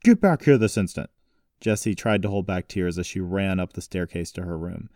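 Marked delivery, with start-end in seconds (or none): 0.0-1.1 loud, raised-pitch command; angry shouted summons; shouted order. A narrator's reading. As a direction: call after someone sternly and loudly. Grandfather orders Jessie back.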